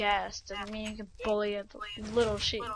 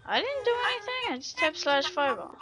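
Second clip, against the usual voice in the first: indignant protest; 7 semitones higher than usual. Real, not acted. Accused of freezing friend's game.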